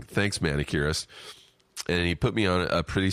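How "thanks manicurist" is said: ordinary talk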